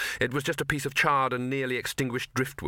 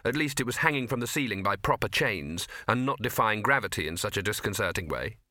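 usual speaking voice

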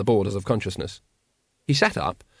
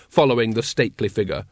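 usual speaking voice

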